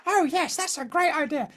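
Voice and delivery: high squeaky mock-wife voice; wife impression. Wife agreeing to watch Ricky Gervais, imitated in a high voice. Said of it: "She doesn't really speak like that".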